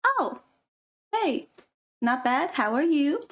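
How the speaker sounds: lightly voiced friend answering brightly; chirpy reply. Second girl answers in skit.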